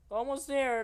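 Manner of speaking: high, loud urging shout; doctor yelling encouragement to SpongeBob; yelled. Acted. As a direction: call it over the screaming. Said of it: "Doctor Twilight yelled".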